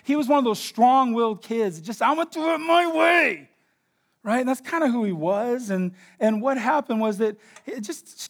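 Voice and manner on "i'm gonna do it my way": put-on higher, stubborn kid voice; defiant kid. Strong-willed boy insists on his own way, defiant. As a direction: toss it off like a willful boy.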